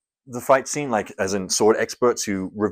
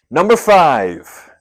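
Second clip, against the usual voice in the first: countdown call; 5 semitones higher, 9 dB louder, much slower than usual. Acted. Dramatic countdown reveal.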